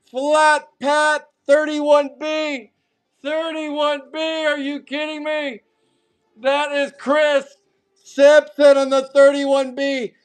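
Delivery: loud, high, stretched-out shouting over the pulls; high, loud, hyped card-break shouting; hyped yelling. Real, not acted. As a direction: yell it like you can't believe the luck. Card break hype over red hits.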